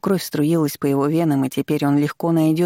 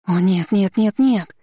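rising, louder sobbing no's; sobbing cry; 4 semitones higher, 4 dB louder, slower than usual. Acted. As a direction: break down crying out. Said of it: "С рыданиями рухнула она". (с рыданиями)

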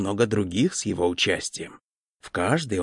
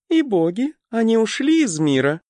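put-on young fox woman voice; 13 semitones higher, 5 dB louder than usual. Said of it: "сказала невысокая лисицида".

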